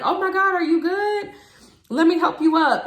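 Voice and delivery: imitating alarmed guys, high and loud; worried helpers. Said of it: "They're like".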